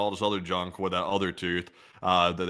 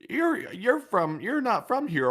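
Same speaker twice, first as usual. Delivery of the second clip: dentist quote; 14 semitones higher, slower than usual. Retold or imitated.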